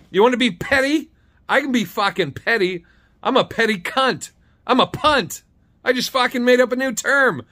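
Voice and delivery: high, loud, defiant boasting; gleefully petty outburst; worked-up rant. Real, not acted.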